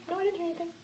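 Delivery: ordinary delivery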